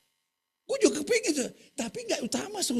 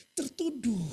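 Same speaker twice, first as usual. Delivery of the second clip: usual speaking voice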